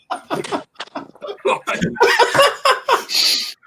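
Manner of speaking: loud breathy burst of real laughter, high. Real, not acted. Laughing hard at the parents' complaint about their son, a high airy burst of laughter.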